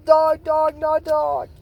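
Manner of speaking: a startled rabbit spotting a dog; high alarmed bunny. Retold or imitated. Bunny spotting the dog, high and panicky. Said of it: "Clover goes".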